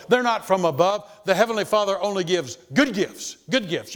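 loud sustained pulpit declaration; full-force preaching. Acted. Preacher telling congregation where good gifts come, loud preaching. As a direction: proclaim it to the back row.

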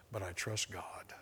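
soft, slow whisper; whispered trust. Retold or imitated. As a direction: breathe it out as a quiet confession. Quiet confession of trust.

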